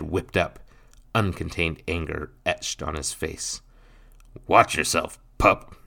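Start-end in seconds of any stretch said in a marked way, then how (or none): 4.5-5.8 put-on louder, smug warning to the boy; smirking warning. Stepfather warning the boy, smug and louder. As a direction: sneer it down at the pup. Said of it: "Nathan smirked".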